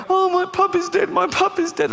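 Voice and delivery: high-pitched put-on child's wail; mock wailing child. Niece telling her uncle the puppy died, mock wailing. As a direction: imitate a distraught little girl, voice way up.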